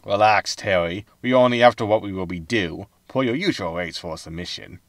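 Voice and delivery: ordinary talk, nothing marked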